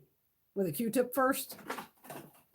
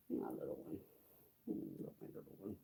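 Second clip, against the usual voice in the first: much lower, hushed tender murmur to a pet; tender cooing; 19 dB quieter than usual. Real, not acted. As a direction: murmur lovingly into the kitten's fur. Cuddling the tiny kitten.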